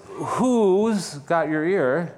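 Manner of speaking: very slow, big weight on "who's"; stress on who's. Shown as a demonstration. Preacher asks about your source of authority, stress on who's. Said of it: "A different emphasis on different words".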